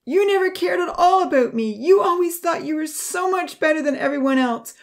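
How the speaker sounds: high, loud, tearful accusation; anguished outburst, rising to a high wail; bitter sobbing. A narrator's reading. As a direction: hurl the blame through bitter sobs, pitch climbing toward despair. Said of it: "she sobbed bitterly".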